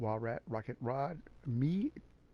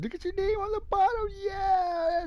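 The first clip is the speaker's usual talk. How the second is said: much higher and louder, near a cry of joy; excited squeal; 5 dB louder, slower than usual. Real, not acted. Streamer spots his friend's name on the trophy, excited squeal. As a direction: shriek with delight at the news.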